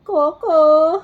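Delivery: high, loud call of the dog's name. Real, not acted. Owner calls her dog Koko over, loud high call.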